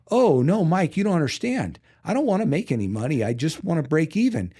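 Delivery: mock giddy optimism; eager naive reply. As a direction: brush off the warning like an excited kid. Retelling his naive eager reply.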